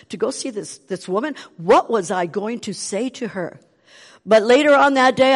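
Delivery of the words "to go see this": usual speaking voice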